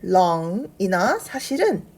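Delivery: normal talking voice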